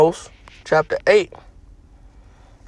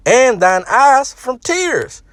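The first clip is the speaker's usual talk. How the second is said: slow and raised; scripture reading; 6 semitones higher, much slower than usual. Acted. Preacher reading the verse, slow and lifted. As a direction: drag each word out solemnly.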